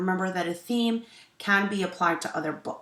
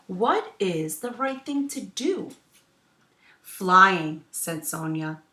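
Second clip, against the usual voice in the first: story narration; 2 semitones higher, 7 dB louder, slower than usual. A narrator's reading. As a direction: clear storytelling voice for children. Teacher reads picture book aloud.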